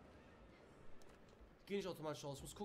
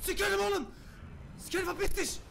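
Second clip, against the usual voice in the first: shrieked far higher and much louder; screaming in panic; 12 dB louder than usual. Real, not acted. Streamer screaming at the skeleton chasing him, panicked shriek. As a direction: scream at the monster to get lost. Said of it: "Ich, ich bin zu laut".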